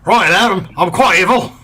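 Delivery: mock evil Brit; evil British accent. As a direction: purr it like a smug Bond baddie. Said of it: "the evil Peter Langdon's accent".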